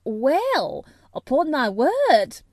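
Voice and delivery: put-on the housekeeper overhears the children, astonished, in broad Yorkshire. A narrator's reading. Loud amazed Yorkshire gasp of surprise. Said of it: "speaking rather broad Yorkshire herself".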